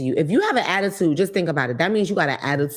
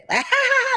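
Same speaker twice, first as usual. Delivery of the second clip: high-pitched mock laugh; imitated laughing. Africans laughing once it's pointed out, imitated laugh. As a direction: burst into a high put-on cackle. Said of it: "but they'll start laughing".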